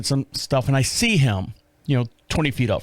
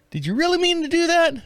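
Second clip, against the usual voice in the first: much higher incredulous question; mock high voice; 14 semitones higher than usual. At the front registers, a mock high incredulous voice. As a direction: squeak it in disbelief.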